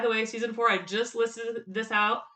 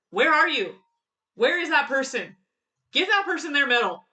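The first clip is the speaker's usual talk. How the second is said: higher, louder playful call for a missing person; mock calling out; 4 semitones higher, 5 dB louder than usual. Fan hunting the person who tweeted it, playful calling out. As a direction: call out loudly, hammed-up.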